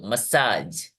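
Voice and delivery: मसाज closed on the j sound; silent final E. Shown as a demonstration. Teacher repeating the correct form, मसाज without ee. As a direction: say मसाज with nothing after the J. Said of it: "हम बोलते हैं".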